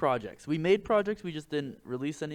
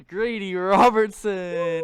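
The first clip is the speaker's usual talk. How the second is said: announcer; 5 semitones higher, 12 dB louder, much slower than usual. Pretend. Introducing the special guest.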